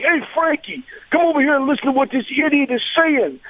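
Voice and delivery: high, brash Brooklyn call across the room; comic Brooklyn tough guy. Retold or imitated. Co-pilot from Brooklyn calling a buddy over, in a brash, comic Brooklyn voice. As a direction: holler for Frankie like a New York wise guy. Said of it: "that's said in comic fashion".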